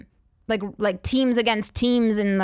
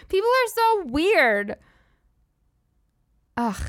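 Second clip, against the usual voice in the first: exasperated; 6 semitones higher, slower than usual. Real, not acted. Exasperated comic outburst.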